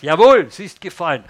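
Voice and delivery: loud emphatic confirmation; an emphatic heavenly voice; voice from above. Acted. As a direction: boom it down from heaven. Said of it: "Dann kommt die Stimme von oben".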